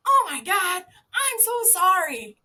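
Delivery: high, loud, drawn-out sorry; retold loud apology. Zookeeper apologising to couple on overlook, high loud apology. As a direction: call up a mortified apology. Acted apology to watching visitors.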